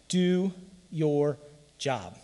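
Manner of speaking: slow, deliberate answer to his own question; preacher answering how you win; Belichick's motto. Retold or imitated. Slow and deliberate. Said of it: "the great philosopher Bill Belichick".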